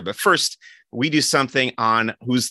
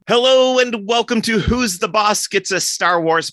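excited greeting; 11 semitones higher, 4 dB louder than usual. Real, not acted. Host's show opening.

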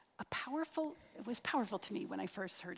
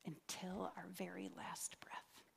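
whisper; 4 semitones lower, 14 dB quieter than usual. Acted. End of prayer, dropping voice.

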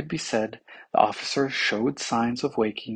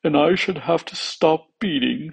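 raised voice of the heart; worried heart; 4 semitones higher, 4 dB louder than usual. A narrator's reading. Heart foresees it must stop beating, worried. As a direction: lament in a slightly higher character voice.

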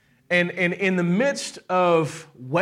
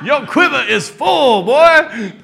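loud, higher, slow, laughing hard; laughing shout; 8 semitones higher, 10 dB louder, slower than usual. Acted. God teasing the mother of many kids, shouting through laughter. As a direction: crow it through big laughter.

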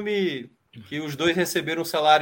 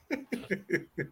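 short, quiet, high laugh; soft amused chuckle; 8 semitones higher, 10 dB quieter than usual. Real, not acted. Co-host amused that the actor always plays the same character, laughing.

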